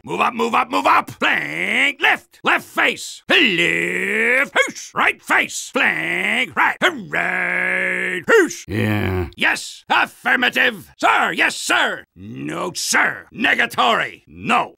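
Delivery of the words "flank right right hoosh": normal talking voice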